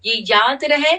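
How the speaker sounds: usual speaking voice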